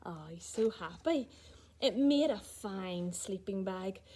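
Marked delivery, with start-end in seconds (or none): none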